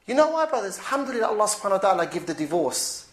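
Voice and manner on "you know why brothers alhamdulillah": normal talking voice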